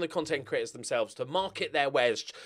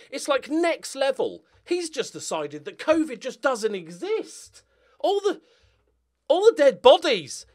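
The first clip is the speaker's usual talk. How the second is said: much higher and louder, slowed in disbelief; incredulous rising outrage; 11 semitones higher, 5 dB louder than usual. Real, not acted. YouTuber marvelling at a covid denial comment, incredulous. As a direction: build up in stunned amazement.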